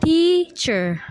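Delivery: "teacher" split into two drawn-out syllables. Shown as a demonstration. Student showing the syllables of "teacher", split slowly. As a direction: break "teacher" slowly into tea, cher. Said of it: "the syllables are".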